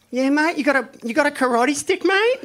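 high, loud Aussie challenge; mock Aki, high and cocky; Aussie accent. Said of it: "he gets up, he's like".

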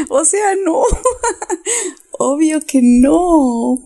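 high, drawn-out laughing protest; amused, squealing with laughter. Real, not acted. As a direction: deny it through giggles. Cracks up at the question.

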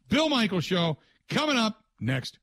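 radio promo voice; show announcement. Acted.

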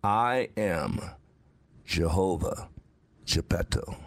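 low, hushed divine voice heard inside; slow solemn inner voice of the Lord; voice of God in his head. Retold or imitated. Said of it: "I hear this in my head".

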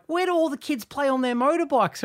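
high blokey question; boisterous man. Retold or imitated. The man asks the old lady again, high and blokey. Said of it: "And he was like".

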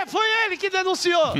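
excited accusation. Pretend. Jokingly blaming the man for the complaint, high and excited. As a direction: point the finger with a gleeful cry.

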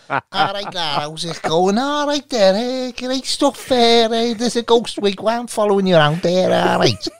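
put-on high, chirpy Liverpool accent; lightly mock a Scouse medium. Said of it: "Derek Acora is a Scouse fella".

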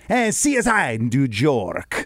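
put-on slow, drawn-out Spanish-accented announcer. Traveller imitating Cuban hotel TV, Spanish-accented announcer. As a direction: imitate a Spanish dub announcing a show title. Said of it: "I hear the Spanish, then I hear".

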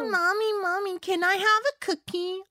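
put-on high-pitched little kid voice; high, slow, wheedling child; child asking mother. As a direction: plead like a small child asking mom. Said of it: "어렸을 때 아이들한테".

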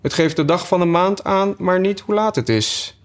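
high-pitched, wondering Alice; puzzled Alice. Acted. Alice remarking on the odd watch, high and puzzled. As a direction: pitch it well up, a baffled young heroine.

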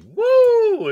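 loud high whoop to kick off the show; a big hype cheer to open; whooping at the top of the episode; excited whoop. Real, not acted. Podcast host hyping the intro.

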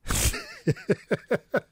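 high laugh. Real, not acted. Laughing at falsetto joke.

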